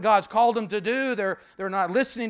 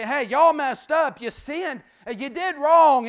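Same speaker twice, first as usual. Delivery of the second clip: mock scolding; 5 semitones higher, 7 dB louder, slower than usual. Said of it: "didn't start in and say".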